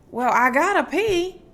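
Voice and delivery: ordinary talk, nothing marked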